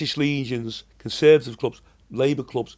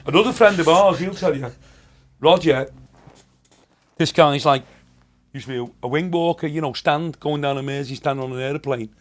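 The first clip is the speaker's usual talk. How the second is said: normal talking voice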